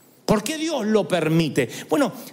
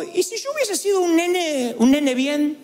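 put-on higher pleading child's tone; mock whiny kid; 8 semitones higher than usual. Preacher imagining himself as a spoiled child. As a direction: ask it like a spoiled little boy.

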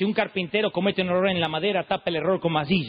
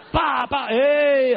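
higher, louder shout; sudden shout; 6 semitones higher, 5 dB louder, much slower than usual. Retold or imitated. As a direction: bark at the kid. Padre grita al hijo. (el padre le grita al nene, fuerte y agudo)

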